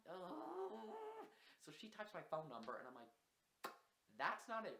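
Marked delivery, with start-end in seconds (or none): 0.0-1.3 high strained groan; exasperated ugh. Retold or imitated. Exasperated groan at drunk friend. 4.2-4.8 louder, higher snap; loud scolding. Retold or imitated. Scolding drunk friend, loud.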